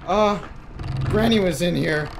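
shocked outburst; startled. Real, not acted.

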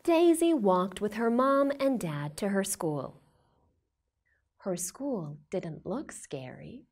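plain reading voice

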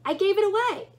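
high, a touch testy; a trifle testy. A narrator's reading. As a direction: snap it out a little, then regret it. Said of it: "a trifle testily".

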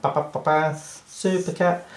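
high cartoon fanfare; playful high superhero jingle; mock hero fanfare. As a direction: trumpet the flying cat's arrival.

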